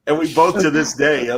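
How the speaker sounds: ordinary talk, nothing marked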